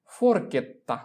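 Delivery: ফোরকেত্তা said as for-KET-ta with a held double t; teacher's clear ফোরকেত্তা; Italian pronunciation. Shown as a demonstration. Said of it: "তাহলে তার উচ্চারণ হবে".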